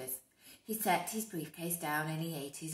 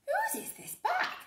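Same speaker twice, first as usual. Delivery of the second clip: much higher, asking voice; teacher; 3 dB louder than usual. Acted. Teacher asking the children about the bag. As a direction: ask like a teacher. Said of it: "said the teacher".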